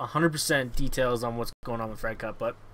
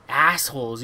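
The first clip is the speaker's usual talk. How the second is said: ordinary talk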